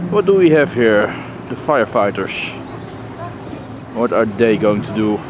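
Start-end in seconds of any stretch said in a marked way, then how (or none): none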